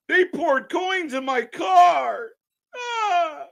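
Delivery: high slow blubbering shout ending in a wail; mock sobbing whine. Streamer mimics the crying wrestler, fake sobbing whine. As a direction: ham up a man crying over coins.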